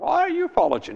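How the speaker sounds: hammed-up maid pointing the finger; high squeaky imitation of an accusing servant girl. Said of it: "and the little maid says".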